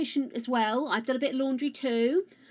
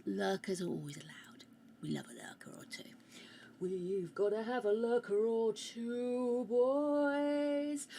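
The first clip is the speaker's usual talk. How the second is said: soft conspiratorial whisper; 6 dB quieter than usual. Real, not acted. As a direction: whisper to the lurkers. Whispering to lurkers.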